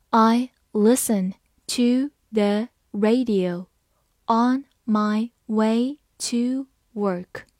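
slow, word by word; slow reading. Shown as a demonstration. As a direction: read it slowly once for learners. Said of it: "好，慢读一遍". (慢读一遍)

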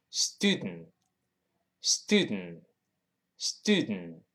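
"student" with nasal d and unreleased t; slow, drop the final t in "student"; nasal plosion. Shown as a demonstration. Teacher models reduced "student", very slow. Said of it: "所以读成".

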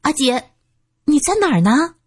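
high, strained question; holding back nausea. A narrator's reading. Su San searches the foul-smelling flat for her friend, holding back nausea. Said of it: "苏三强忍着问道".

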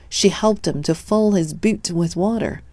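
ordinary delivery, nothing marked

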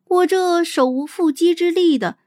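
ordinary delivery

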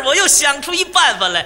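ordinary delivery

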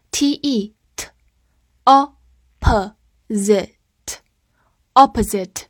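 "opposite" broken slowly into its sounds, then whole; sounded out. Shown as a demonstration. Teacher breaking a new word down for learners, sounded out. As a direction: sound out "opposite" piece by piece with pauses.

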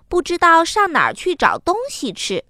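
ordinary delivery, nothing marked

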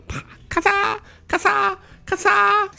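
very high clicking shutter sounds; camera click noises. Acted. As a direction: mimic a phone shutter firing. Imitating a phone camera shutter.

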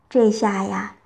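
plain reading voice

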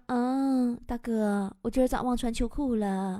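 soft, low, sheepish confession; a hint of an embarrassed nurse; lightly voiced timid nurse. Nurse explaining her shaking to the patient, soft and sheepish.

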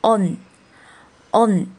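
"on" drawn out and closed on n; front nasal ending. Shown as a demonstration. Teacher combining vowel and final for learners, slow "on" ending in n. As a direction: say "on" slowly, twice, with a pause between. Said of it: "就是on，on".

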